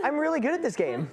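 high, fast gloating; teasing, squealed self-congratulation; mock pleased player. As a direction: imitate someone thrilled with their own skill. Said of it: "she's like".

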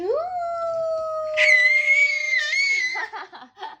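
big high laugh bursting out; loud burst of laughter. Real, not acted. Parent laughing at baby feeding.

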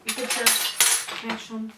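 ordinary delivery

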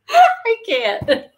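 high, laughing too hard to talk; cracking up completely; helpless laughter. Real, not acted. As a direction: gasp it out through real giggles. Too amused to answer.

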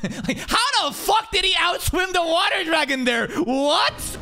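can't believe the swim; incredulous. Real, not acted. Streamer shouts in disbelief at the anime, incredulous.

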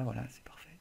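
hushed confiding whisper. Acted. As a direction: breathe it like a secret aside. Conspiratorial aside to viewers.

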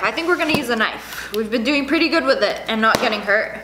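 ordinary delivery

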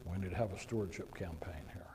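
mocking stage whisper, fully breathy; a sly aside breathed, not voiced. Mocking aside about church fundraising.

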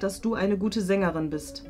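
ordinary delivery

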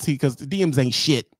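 ordinary delivery

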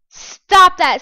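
loud, sharp stop that; angry shout. Real, not acted. Player yelling at another player, angry shout. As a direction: yell at the attacker to quit.

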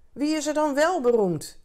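high, loud shouted question; called out. A narrator's reading. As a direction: call the question back down the street. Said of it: "en riepen". (hoog en luid roepend vragen)

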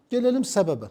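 ordinary delivery

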